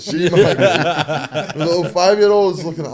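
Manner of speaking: high, loud, laughing through it; can barely get it out for laughing. Real, not acted. Fighter laughing while telling the cheesecake story.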